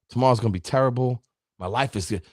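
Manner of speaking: a gloomy pessimist grumbling; pessimistic voice. Shown as a demonstration. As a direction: sound like the day is already lost. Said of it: "One was pessimistic".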